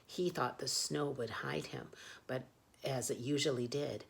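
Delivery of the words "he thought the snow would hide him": usual speaking voice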